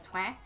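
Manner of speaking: "twang" as French toi with a Quebec nasal vowel. Shown as a demonstration. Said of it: "a little bit more of a twang".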